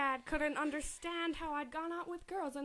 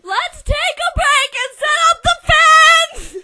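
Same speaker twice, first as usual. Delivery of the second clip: a loud, high, slow call over the roar; shout; 11 semitones higher, 20 dB louder, slower than usual. Acted. Archie calling out to the cheering group over the roar. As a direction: shout it over the crowd noise. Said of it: "Archie shouted".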